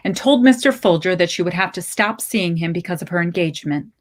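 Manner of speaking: usual speaking voice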